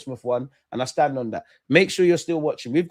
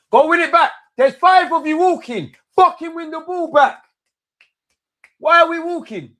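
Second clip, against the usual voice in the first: high, loud shouts at the players; frustrated yelling; 7 dB louder than usual. Real, not acted. Fan yelling at his team to win the ball back. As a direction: bellow at them to win it back.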